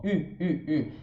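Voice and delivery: U repeated slowly through puckered lips; slow fronted U, between "i" and "u"; French U with pout. Shown as a demonstration. Teacher showing the pout to learners, French U. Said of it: "de novo aqui o biquinho".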